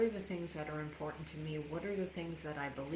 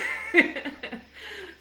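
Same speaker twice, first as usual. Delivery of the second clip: loud laugh; 14 semitones higher, 11 dB louder than usual. Real, not acted. Laughs after joke to God.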